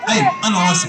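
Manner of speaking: very low-pitched puppet character; Aragoz puppet. Acted. Puppet introducing himself to the host, Aragoz puppet voice. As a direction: play a chatty puppet, far below your own pitch. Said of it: "الأراجوز ده وهو بيرغي".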